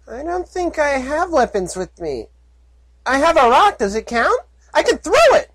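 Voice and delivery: high, eager filly offering her rock; chirpy little filly trying to help. Acted. Filly offering to throw her rock, high and eager. Puppy voice held.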